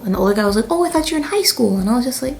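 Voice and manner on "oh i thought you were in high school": surprised exclamation. Retold or imitated. Older man's surprised remark.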